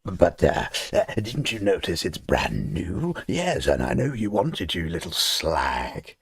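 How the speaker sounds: slow, smug put-on voice of a greedy company; mock company voice. Games Workshop mocking its customers, slow, smug put-on voice. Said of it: "G W are like".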